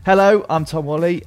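ordinary talk

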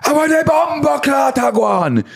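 very high, strained exclamation; mock outraged cry. Shown as a demonstration. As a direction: cry it out far above normal pitch. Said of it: "It's like, more like".

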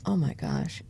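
ordinary talk

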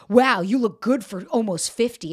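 slow, loud mock-sweet backhanded compliment; snide troll voice. Host mocking a troll's backhanded comment, slow and snide. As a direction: sneer it like a smug troll.

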